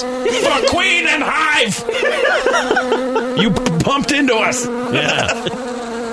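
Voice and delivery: high, slow, heroic bee voice; rally the hive like tiny soldiers; bee warrior voice. Acted. Voicing bees as warriors.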